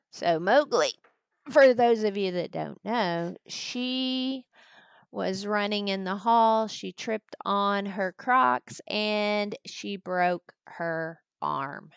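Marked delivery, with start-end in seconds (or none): none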